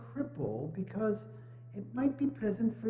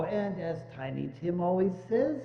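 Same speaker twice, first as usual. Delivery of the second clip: ordinary talk, nothing marked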